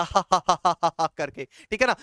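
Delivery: a light mock villain laugh, ha ha ha; Ravan's laugh. Teacher imitating Ravan's roaring laugh for the class, a light mock villain laugh. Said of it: "जैसे रावण हंसता था".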